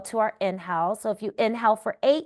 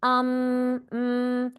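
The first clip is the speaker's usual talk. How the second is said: humming; 2 semitones higher than usual. Shown as a demonstration. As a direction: hum gently on one note. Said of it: "You can hum".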